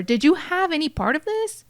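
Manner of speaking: high-pitched, urgent acted demand. A narrator's reading. Hysterical grandma grabbing Erin's face to question her, demanding. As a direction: voice an upset grandmother pressing for an answer. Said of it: "demands her".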